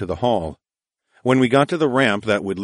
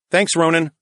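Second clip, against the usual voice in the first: raised, higher call across a distance; 9 semitones higher, 4 dB louder than usual. Acted. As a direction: call out brightly to him. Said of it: "I called out".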